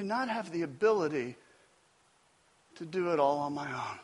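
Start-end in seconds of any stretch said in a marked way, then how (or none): none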